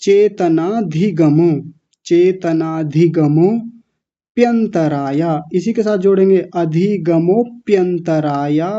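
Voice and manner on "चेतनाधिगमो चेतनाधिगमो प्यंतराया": चेतनाधिगमो broken into slow parts; slow syllable drill. Shown as a demonstration. Teacher drills the compound word slowly. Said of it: "थोड़ा और तोड़ते हैं".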